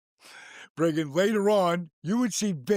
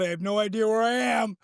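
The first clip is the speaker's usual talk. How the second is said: retold shout; 7 semitones higher than usual. To Bobby after the knockout, a retold dazed shout. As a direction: yell it at your partner, half lost.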